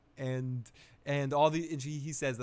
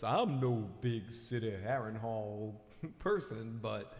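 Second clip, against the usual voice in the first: Davos voice; 3 semitones lower, 3 dB quieter than usual. Retold or imitated. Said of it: "maybe I just wanna do this voice".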